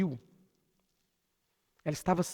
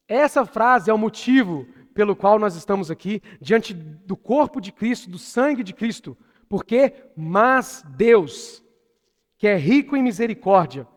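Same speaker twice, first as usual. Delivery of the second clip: loud, emphatic proclamation of scripture; impassioned preaching; 4 semitones higher, 9 dB louder than usual. Real, not acted. Preacher proclaims God's mercy to the church, impassioned. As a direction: proclaim each phrase with weight and force.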